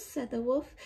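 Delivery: normal talking voice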